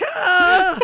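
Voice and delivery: high, loud, drawn-out "Ah!"; mock battle cry. Co-host acting out the menacing magazine cover face, mock battle cry. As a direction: imitate a fierce attack yell.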